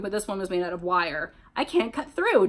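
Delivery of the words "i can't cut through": higher, strained-for-help call at moderate volume; yelled. A narrator's reading. Jeff trying to cut the wire net, moderate yell. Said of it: "Jeff yelled".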